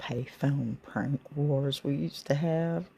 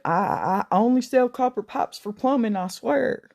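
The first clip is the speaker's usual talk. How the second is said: higher, louder, panicky stammer; stammering scared old shopkeeper, pitched up; 6 semitones higher, 4 dB louder than usual. Retold or imitated. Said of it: "He starts stammering".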